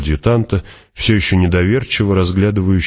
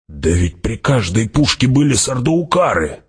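inner cry of shock; 7 semitones higher than usual. A narrator's reading. Hawat cries out in his head, astonished and higher. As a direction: let the pitch climb in astonished thought. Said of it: "мысленно закричал Хават".

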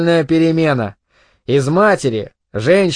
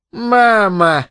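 higher, drawn-out mock of the boy; mocking mimicry; 5 semitones higher than usual. A narrator's reading. Mother mimics her son's protest, mocking. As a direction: mimic his protest, stretching it out. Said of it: "передразнила она Генри".

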